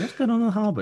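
laughing mock bystander. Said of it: "everyone's like".

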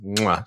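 exaggerated kissing "mwah"; chef's kiss. Co-host savours a terrible accent, mock chef's kiss. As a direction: smack a big mock-approving kiss.

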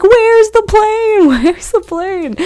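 high, drawn-out mock scream. Retelling screaming for the plane at the sighting, mock scream. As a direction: squeal it slowly, imitating your panicked self. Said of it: "And I'm screaming".

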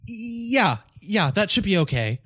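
high, hesitant and slow; nervous kid voice. Retold or imitated. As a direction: drag it out like an unsure kid. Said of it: "And he's like".

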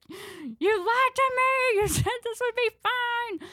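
whiny accusation. Retold or imitated. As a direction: drag it out like a betrayed kid. Kid accusing sister, whining.

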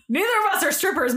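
ordinary talk

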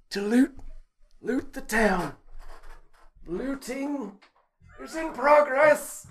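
very high mock Viking voice like a very old woman; a squeaky, ancient Viking raider; hammed-up high Viking accent. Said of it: "you sounded like Vargelia ... ninety-five years old".

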